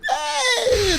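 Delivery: squeaky high mock "Hey!"; a high startled yelp, hammed up. Cop's outburst in retold scene.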